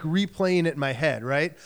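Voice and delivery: ordinary talk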